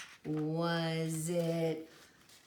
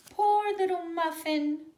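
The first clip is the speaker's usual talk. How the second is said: high, loud pitying cry; pitying people; 12 semitones higher, 9 dB louder than usual. Acted. Townsfolk pity the blind dog, loud and high. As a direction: coo it with pity, much higher. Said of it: "said some people on the street".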